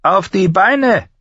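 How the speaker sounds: high, slow, taunting order; commanding shout. Acted. Master-at-arms orders the boy up, commanding. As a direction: draw the order out, pitched high.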